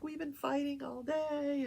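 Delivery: mild retold wail; imitated sobbing. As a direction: imitate an upset woman's tearful whine. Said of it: "and she's sobbing".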